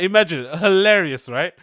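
ordinary talk

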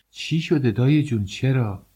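high, alarmed plea on دایی جون. Acted. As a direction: cry out چی شده دایی جون in sudden fear. Shaken by bad news.

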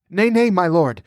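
high, hurried-to-appease plea; trembling protest. A narrator's reading. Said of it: "The high priest was trembling".

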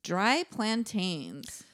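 very slow, deliberate; slow deadpan. Acted. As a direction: drop each word slowly. Deadpan game word.

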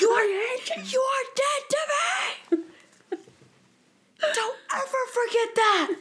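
very high crying shout; mock yell. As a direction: scream it through the blinds, sobbing. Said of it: "he yells through the office windows".

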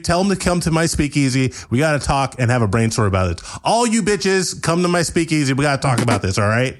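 high, fast, bossy orders; bossy Sam. Acted. Sam barking orders at Pete, high and fast. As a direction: order the assistant around in a rush.

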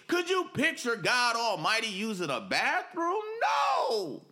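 fiery preacher's shout; preaching shout. Real, not acted. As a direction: build to a full shout of denial. Preacher's rhetorical shouted question.

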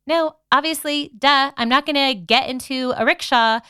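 snappy, dismissive "no way" reply; sassy refusal. Retold or imitated. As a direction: brush off a stranger with mock scorn. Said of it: "And I'm like".

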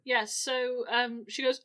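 ordinary delivery

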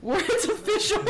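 laughing. Real, not acted. Laughing about owning house.

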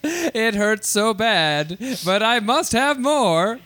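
drawn-out mock suffering; slow hammy agony. Hamming up eating robots.

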